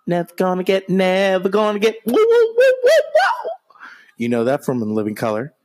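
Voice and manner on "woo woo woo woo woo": mocking woo chant. Mocking chant after insult.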